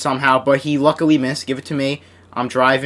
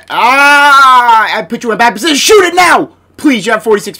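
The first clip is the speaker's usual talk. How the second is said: much higher and louder, frantic; urgent shout; 7 semitones higher, 8 dB louder than usual. Real, not acted. Gamer urging his player to shoot, a frantic shout. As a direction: yell the order before time runs out. Urgent plea at the shot clock.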